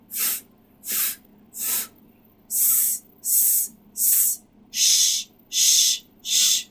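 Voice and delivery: "f", "s" and "sh" hissed with air only; isolated unvoiced fricatives; unvoiced consonants. Shown as a demonstration. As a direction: breathe out each consonant, no voice. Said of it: "each of these will be followed".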